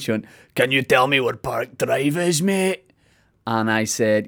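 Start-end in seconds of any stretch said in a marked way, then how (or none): 0.5-2.9 slow, imitated American accent, lifting on mate. American lady asking him for directions, imitated American accent. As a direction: put on an American tourist's drawl. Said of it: "she said in her American accent".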